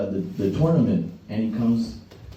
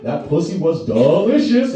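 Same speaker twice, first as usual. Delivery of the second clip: slow, raised smug voice; smug Tony; 5 semitones higher, 4 dB louder than usual. Retold or imitated. Tony the Tiger taunting the dad before fleeing, slow and smug. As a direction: savour the insult slowly.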